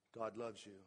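low and soft, gently reassuring; soft reassurance. Retold or imitated. Pastor answering a troubled member, soft and reassuring. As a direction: tell them softly God loves them.

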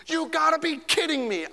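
high, disbelieving onlooker; mock disbelief, pitched high; incredulous. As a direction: act out the shock of seeing him. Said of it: "I added that".

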